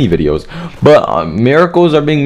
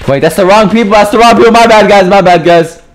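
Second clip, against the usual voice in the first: higher, louder hurried realization; alarmed apology; 7 semitones higher, 4 dB louder than usual. Real, not acted. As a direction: blurt it out as you notice the mistake. Realizes he bombed wrong people.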